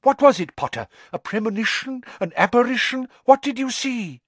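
high, eager volley of questions; excited. A narrator's reading. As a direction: press the student for a vision. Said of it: "looking thoroughly excited".

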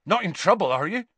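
worried half-question; alarmed Hagrid. A narrator's reading. To Harry about Slughorn, mildly alarmed. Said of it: "looking up, alarmed".

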